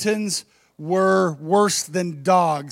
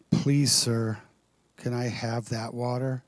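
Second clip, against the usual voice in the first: small, low, hesitant plea; quiet hesitant please sir; timid mumble; 7 semitones lower, 6 dB quieter than usual. Shown as a demonstration. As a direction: ask meekly as if unsure. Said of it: "You think that's how she was talking to Jesus?".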